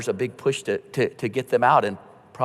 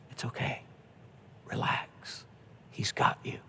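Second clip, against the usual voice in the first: hushed whisper; 13 dB quieter than usual. Retold or imitated. Pastor soothing dying person at bedside.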